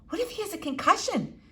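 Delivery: put-on high, anxious little-girl voice; worried girl. Flora asks her father, a girl's worried high voice.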